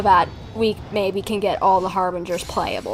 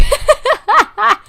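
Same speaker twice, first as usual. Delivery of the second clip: short high laugh; 13 semitones higher, 6 dB louder than usual. Real, not acted. Laughs at her own joke.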